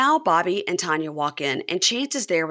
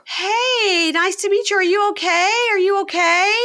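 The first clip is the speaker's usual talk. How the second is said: much higher, louder, sugary concern; high sweet Tanya voice; 14 semitones higher, 6 dB louder than usual. Retold or imitated. Tanya greeting the crying girl, high and sugary. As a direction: play the overly sweet newcomer. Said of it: "and Tanya goes".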